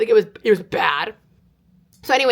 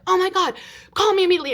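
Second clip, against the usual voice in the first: high, fast panicked reply; mock maternal alarm, pitched up; alarmed mom; 10 semitones higher, faster than usual. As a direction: imitate a mother urgently demanding a call. Imitates alarmed mom answering cult message.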